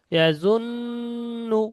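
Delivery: ইয়াজুন্নু with a long hummed n, held high; slow ইয়াজুন্নু, the doubled n drawn out nasally; nasal ghunna. Shown as a demonstration. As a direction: hold the ন in ইয়াজুন্নু in the nose, then release to nu. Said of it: "নুনের আওয়াজটাকে নাকের মধ্যে কিছুক্ষণ ধরে রাখা".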